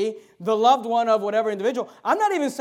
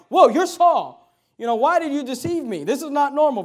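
startled realization; 5 semitones higher, 8 dB louder than usual. Acted. The woman realizes it is Saul.

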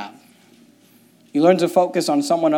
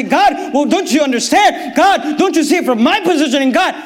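mock protest; 10 semitones higher, 5 dB louder than usual. Mock complaint to God, shouted.

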